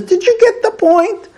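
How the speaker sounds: hammed-up tough woman daring him to argue; gun-waving wife. Imitating the wife after firing her gun, mock menace. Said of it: "she fires a shot in the air and says".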